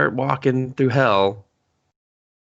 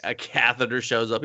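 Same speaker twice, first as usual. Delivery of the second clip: ordinary talk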